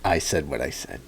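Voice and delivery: low, quiet deadpan impression; dry, low-key mock seriousness; Matt Walsh. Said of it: "Like I said, he's deadpan".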